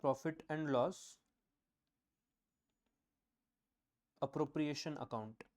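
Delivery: slow, word by word for notes; slow dictation. Shown as a demonstration. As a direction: dictate it slowly so they can write. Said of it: "Items comprising of". (धीमी आवाज़ में लिखवाना)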